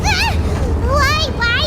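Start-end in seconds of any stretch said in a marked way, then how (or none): 0.0-0.3 startled cry. Acted. Elevator suddenly moves.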